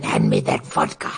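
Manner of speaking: low Russian-flavored growl. Retold or imitated. Replaying the song line for friends, low mock Russian. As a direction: bark for the vodka like a comrade. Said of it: "So I just kept playing".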